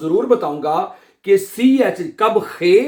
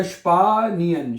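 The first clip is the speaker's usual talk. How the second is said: स्पानियन slowly, first vowel held long; स्पानियन stretched into separate syllables; slow sounding-out; slower than usual. Shown as a demonstration. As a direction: teach स्पानियन as "shpaa-ni-en". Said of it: "क्या बनेगा".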